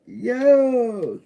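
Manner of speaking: ordinary talk, nothing marked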